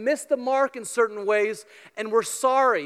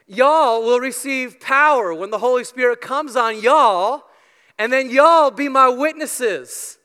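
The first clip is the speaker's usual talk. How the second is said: a light, self-mocking Texan accent, louder; lousy Texan accent; 5 dB louder than usual. Shown as a demonstration. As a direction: preach it in a hammed-up Texan accent with y'all. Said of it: "It's a lousy impersonation".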